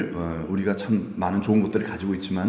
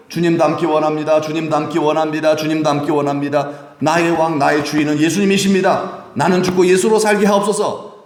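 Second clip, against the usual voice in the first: louder, higher impassioned prayer; fervent prayer; 5 semitones higher, 5 dB louder than usual. Retold or imitated. Congregation praying to the Lord, louder and fervent. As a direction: cry it out to the Lord. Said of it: "두렵고 떨리는 겸손한 마음으로".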